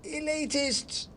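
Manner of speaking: very high, slow squeaky jeer; hidden mouse. Acted. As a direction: heckle from behind the cab. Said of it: "came a voice from behind the cab".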